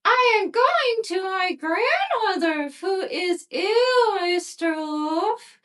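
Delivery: put-on high, slow, sweet little girl. A narrator's reading. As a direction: answer innocently in a high little girl's voice. Girl answers the wolf.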